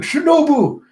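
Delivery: very high, loud yell of a name; alarmed cry. Acted. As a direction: shriek a name in acted panic. Cries out as attacker leaps.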